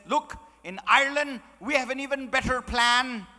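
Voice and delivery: high, loud, hammed-up Irish accent. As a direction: boast of the plan as a comic Irishman. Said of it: "So the Irish fella".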